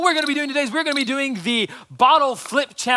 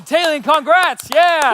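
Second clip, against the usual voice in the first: louder, higher cheer for the winner; excited congrats; 6 semitones higher, 7 dB louder, slower than usual. Real, not acted. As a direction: celebrate the winner out loud. Announcing the winner.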